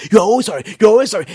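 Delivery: loud, high-pitched mock spouse's yell. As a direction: yell it like a fed-up spouse, acted out. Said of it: "they will be yelling".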